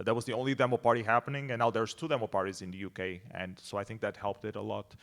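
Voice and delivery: ordinary delivery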